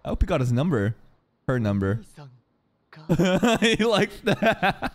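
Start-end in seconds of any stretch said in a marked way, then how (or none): 2.1-4.9 drawn-out words through laughter; laughing. Real, not acted. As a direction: tease slowly while laughing. Amused at the blushing boy.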